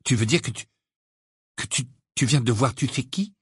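halting, with repeated false starts; hesitant, fearful stammer. A narrator's reading. Boy dreading the answer from his friend, fearful stammer. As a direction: stumble towards the question, slightly scared. Ron stammers, afraid to name him.

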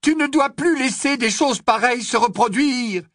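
upset Hermione, high and loud; exclaiming. A narrator's reading. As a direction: cry out in alarm at a friend. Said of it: "s'écria Hermione".

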